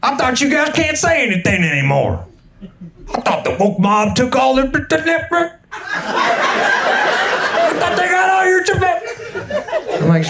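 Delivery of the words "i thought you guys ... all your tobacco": much higher, slowing way down as the neighbor needles him; hammed-up high voice for the teasing neighbor; mocking neighbor. Texas neighbor mocking the comedian, in a high, slowing voice. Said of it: "He's like".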